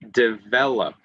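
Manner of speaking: "develop" sounded out with a firm v; emphasised middle V. Shown as a demonstration. Teacher giving a middle V example, deliberate v. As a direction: articulate the v inside "develop". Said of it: "Using V in the middle of a word".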